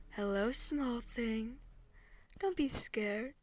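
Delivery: lightly voiced eldritch woman, slow and soothing; soothing but threatening. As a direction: soothe small prey with a touch of menace. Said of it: "It sounds nice, soothing, in a threatening sort of way".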